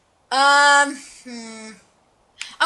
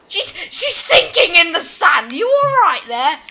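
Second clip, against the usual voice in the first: high, loud, incredulous exclamation; amused alarm; 5 semitones higher, 7 dB louder than usual. Real, not acted. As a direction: cry out at an absurd sight. Startled by character sinking in sand.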